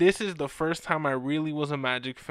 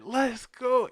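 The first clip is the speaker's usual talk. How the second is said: higher-pitched burst of excitement; retold childhood cheer, pitched up; excited kid; 9 semitones higher, 4 dB louder than usual. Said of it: "I was such a kid".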